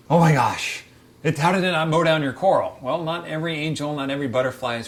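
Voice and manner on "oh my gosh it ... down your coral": louder, higher mock disbelief; mock shock. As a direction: gasp it like a shocked reefer. People's shocked reaction voiced.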